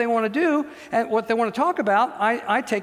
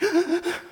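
put-on child's gasp; 10 semitones higher than usual. Child's voice imitated.

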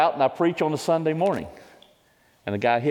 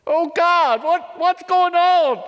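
high, loud anguished cry, the end slowed right down; screamed out; 5 dB louder, slower than usual. Retold or imitated. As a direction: scream it up to God in despair. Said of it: "I screamed out and said".

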